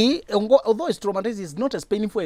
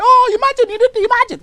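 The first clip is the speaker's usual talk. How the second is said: much higher and louder, mock crying wail; 10 dB louder than usual. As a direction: ham up a sobbing victim. Mocking a crying victim.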